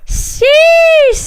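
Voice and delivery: a sharp, very high, loud exclamation; excited squeal of sheesh; high shouted sheesh. Real, not acted. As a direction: yelp "Sheesh!" way up top. Exclaiming at chat.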